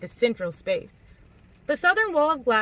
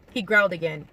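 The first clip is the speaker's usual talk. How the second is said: ordinary delivery, nothing marked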